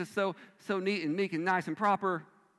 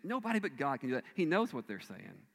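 ordinary talk

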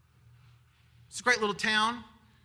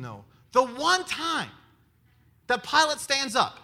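ordinary delivery

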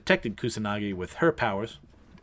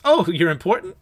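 loud, higher wide-eyed question; impressed surprise; 6 semitones higher, 8 dB louder than usual. Retold or imitated. Kusanagi reacts to the samurai's boast, impressed and surprised. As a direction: act out an eager, impressed listener. Acted awe at the samurai's claim.

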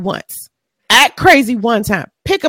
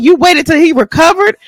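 normal talking voice

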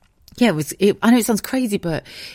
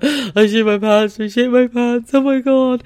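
loud, high horrified wailing; slow, loud panic at the first words; panicked; 8 semitones higher, 5 dB louder than usual. Retold or imitated.

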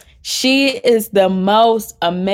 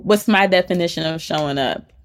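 normal talking voice

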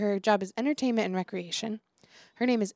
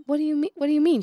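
higher, louder confused repeat; stunned teacher demanding an answer; confused outburst; 6 semitones higher, 5 dB louder, faster than usual. Retold or imitated. Teacher to students after the slur, higher and louder in disbelief.

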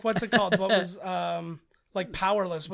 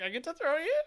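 playful giant voice; 10 semitones higher than usual. Retold or imitated. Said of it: "he's like".

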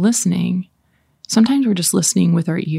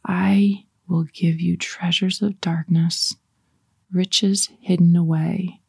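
slow, measured verse reading; meditative scripture reading; slower than usual. Shown as a demonstration. Leader reads the verse once more to savor it, slow meditative reading. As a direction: savor each phrase, pausing after it. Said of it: "I'm gonna read it again".

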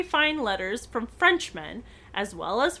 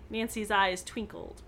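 usual speaking voice

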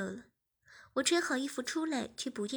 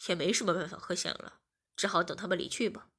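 deeper voiced young man, resigned; lower resigned boyfriend; 5 semitones lower than usual. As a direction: shrug it off. (无奈的低沉男声)

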